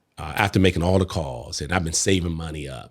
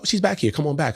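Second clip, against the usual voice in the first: chirpy coworker; 9 semitones higher, faster than usual. Retold or imitated. Coworker Vanessa voiced.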